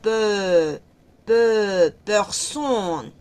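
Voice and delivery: peu with a rounded French vowel; syllable demo. Shown as a demonstration. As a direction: personne opening with peu. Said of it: "el sonido sale de la E junto con la P".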